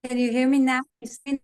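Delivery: ordinary talk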